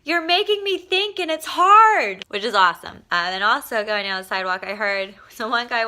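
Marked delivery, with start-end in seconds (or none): 0.0-2.2 high, loud, slow mock yell from a passerby; retold yell. Woman on the street yells at topless [name], slow mock shout. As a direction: belt it slowly like a stranger shouting at you. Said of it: "she's yelling at me".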